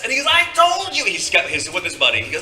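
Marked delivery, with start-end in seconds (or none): 0.3-1.1 high, loud imitated crowing; excited triumph. Excited kid proving it to his buddy, high triumphant yell. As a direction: crow it to your buddy.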